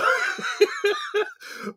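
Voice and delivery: high airy laugh, trailing down; a full burst of laughter; cracking up again on the mic; real laugh. Real, not acted. Host laughing at his name mix-up.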